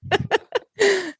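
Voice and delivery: high amused laugh; giggling at the joke. Real, not acted. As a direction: burst of laughter.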